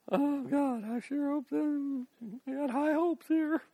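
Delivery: high, pleading mock prayer, almost whimpering; mock wishful prayer. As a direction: parody someone hoping instead of believing.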